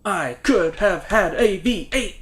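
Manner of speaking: high slow ad-slogan voice; slow commercial catchphrase read; ad pitchman voice. Pretend. Quoting V8 ad.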